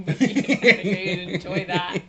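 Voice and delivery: slow, laughing through words. Real, not acted. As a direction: laugh as you say it. Laughing at pot joke.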